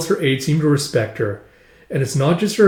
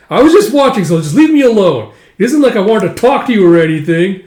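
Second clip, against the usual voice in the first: defensive blurting; 5 semitones higher, 7 dB louder than usual. Acted. Parya caught stalking, blurting defensively.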